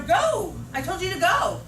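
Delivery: high, loud angry order; mad teacher. Shown as a demonstration. Mad teacher sending a student to refocus, angry high shout. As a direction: shout it at a student, temper lost. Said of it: "Well, at that point, I'm mad".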